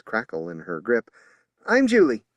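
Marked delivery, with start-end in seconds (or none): 1.6-2.2 higher, louder, clearly spoken; loud introduction. A narrator's reading. Julie introducing herself to a blind woman, loud. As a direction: announce your name firmly.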